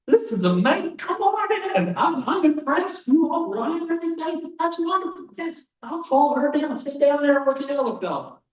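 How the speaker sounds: put-on high, loud, thrilled old farmer; delighted farmer. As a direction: ham up the farmer's over-the-top enthusiasm. Farmer voiced in told joke, delighted.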